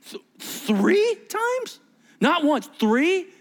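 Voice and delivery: slow, high-pitched disbelief; stammer, then stretch the question up high; aghast, drawn-out questioning; acted shock. Disciple shocked at threefold denial.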